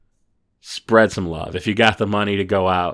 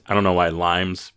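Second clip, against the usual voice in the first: normal talking voice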